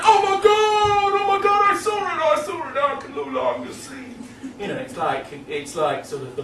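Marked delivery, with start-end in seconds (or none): 0.0-4.1 frantic rural witnesses. Retold or imitated. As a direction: ham up the terrified yokels rolling on the ground.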